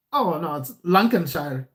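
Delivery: bad mock English accent, correcting him; a clumsy imitation of an English local saying "Lancashire" as "Lankenshire"; an English local's correction in a shaky put-on English accent; terrible English accent. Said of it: "that was a terrible English accent".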